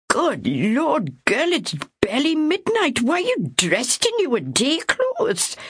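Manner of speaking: high, scolding disbelief, slowing at the end; what is this girl thinking; incredulous older woman. Acted. Ethel puzzled at Myra dressed at midnight, scolding disbelief.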